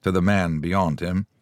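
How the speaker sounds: ordinary delivery, nothing marked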